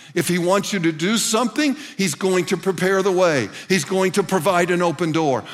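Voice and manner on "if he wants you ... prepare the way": normal talking voice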